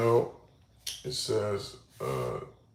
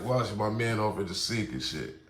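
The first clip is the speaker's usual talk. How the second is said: ordinary delivery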